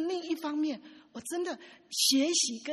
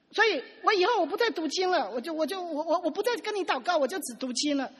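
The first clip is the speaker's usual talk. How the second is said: fed-up complaint; 5 semitones higher, 5 dB louder than usual. Retold or imitated. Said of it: "他就这样很生气的这样".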